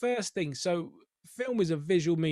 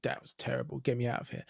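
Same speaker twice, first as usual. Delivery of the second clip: inner thought; 5 semitones lower, 6 dB quieter, faster than usual. Retold or imitated. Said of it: "And you're thinking".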